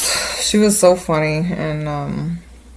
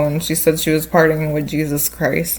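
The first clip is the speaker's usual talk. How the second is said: normal talking voice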